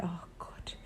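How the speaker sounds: breathy whispered oh God under the breath; exasperated whispered sigh. Retold or imitated. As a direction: whisper it, worn out by the child. Exasperated mother sighing to herself.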